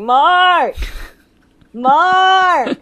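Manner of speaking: loud high call across the house; calling out a name. Retold or imitated. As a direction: call his name from upstairs. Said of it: "I was like".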